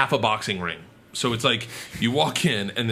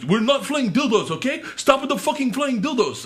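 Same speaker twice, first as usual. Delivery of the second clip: annoyed performer; 12 semitones higher than usual. Retold or imitated. Performer fed up with the heckler, annoyed retort. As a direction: snap back at a drunk heckler. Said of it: "And the guy's like".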